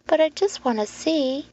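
put-on high-pitched small boy's plea; pleading boy. Boy begging the man to let him see, pleading. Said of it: "pleaded the boy".

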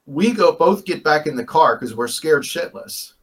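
ordinary talk, nothing marked

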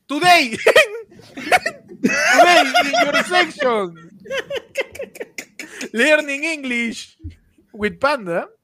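high, drawn-out mock show intro; presentador de sección. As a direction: announce a silly English segment, stretching the words. (voz aguda de presentador en broma)

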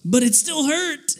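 high-pitched, mock-pained complaint; whiny protest. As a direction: whine it like a hurt kid. Young self protests to doctor.

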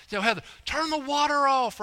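high shouted call to the wife. Retold or imitated. Husband calling to wife to shut off the bath water, loud high call. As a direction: yell across the house to stop the water.

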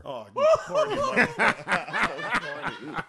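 laughing loud teasing jab. Real, not acted. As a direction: burst out laughing at a friend.

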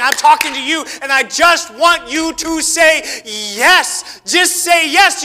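God pleading with the listener, loud and slow; God's pleading call. Retold or imitated. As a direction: stretch it out, begging for a yes. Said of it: "He's saying".

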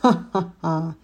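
ominous witch cackle; sinister cackle. A narrator's reading. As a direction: laugh menacingly. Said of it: "зловеще хохотала ведьма".

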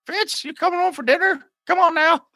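high sweet wife's voice; wife calling. Acted. Fitz's wife calls him home for dinner, high voice. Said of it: "it's his wife".

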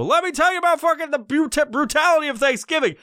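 hammed-up high rant to a barista; oversharing rant. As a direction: pounce on a stranger with wild trivia. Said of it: "go out to fucking Starbucks and go".

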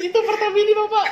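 high-pitched laugh through the words; laughing hard. Real, not acted. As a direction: crack up while saying it. Laughing at Pertamini joke.

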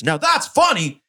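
hammed-up spiteful comeback; mock-angry retort. Angry guy's retort after throwing drink.

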